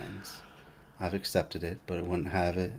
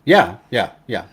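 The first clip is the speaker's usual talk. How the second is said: ordinary talk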